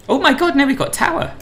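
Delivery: real startled cry, pitch leaping right up; surprised. Real, not acted. Streamer discovering a live tower controller, surprised.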